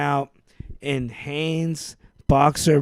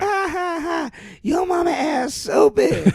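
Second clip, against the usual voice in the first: obnoxious mock voice. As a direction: jeer like a cartoon bully. Nutty Professor juice mockery.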